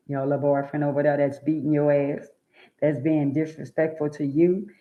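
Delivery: ordinary talk, nothing marked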